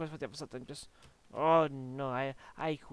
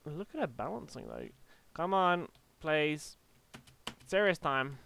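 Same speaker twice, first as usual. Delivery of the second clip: ordinary talk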